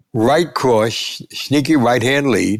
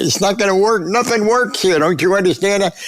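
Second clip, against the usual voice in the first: higher, exasperated retold insistence; 8 semitones higher than usual. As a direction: plead with him, it won't work. Insisting the phone won't work.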